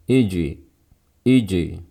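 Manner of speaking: "Iji" with the j release tinted toward i; kasroh-like bounce. Shown as a demonstration. Teacher showing a faulty bounce, i-tinted qalqalah in "Iji". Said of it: "suara qalqalahnya mirip kasroh".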